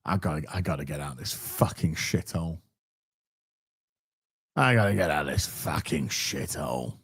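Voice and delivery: low, quiet put-on voice muttering to escape; desperate mutter. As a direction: grumble it under your breath, desperate to leave. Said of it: "I'm like".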